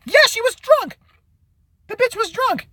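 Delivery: high mocking girly voice; mock girl voice. Mocking the drunk girl who filmed her sister in a high voice. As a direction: sneer it in a high ditzy voice.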